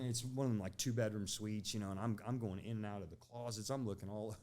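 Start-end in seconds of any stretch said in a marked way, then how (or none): none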